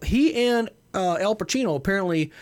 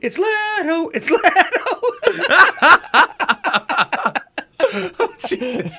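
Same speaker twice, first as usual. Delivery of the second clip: higher, louder, cracking with laughter; laughing; 12 semitones higher, 7 dB louder than usual. Pretend. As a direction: laugh out the name. Laughing announcement.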